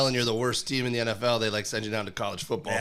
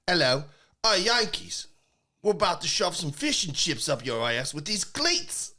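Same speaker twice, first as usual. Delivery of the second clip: high, hammed-up British heckler; cartoonish British jeering, pitched up; British accent; 7 semitones higher than usual. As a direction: taunt the Americans in a broad fake British accent. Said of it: "This is how I get into British one".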